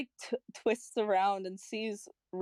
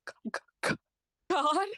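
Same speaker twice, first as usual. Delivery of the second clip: stammering awe; 4 semitones higher than usual. Acted. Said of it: "He perks up and he looks".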